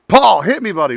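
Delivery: ordinary talk, nothing marked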